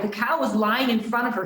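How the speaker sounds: plain reading voice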